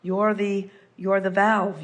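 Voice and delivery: ordinary talk, nothing marked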